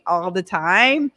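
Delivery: usual speaking voice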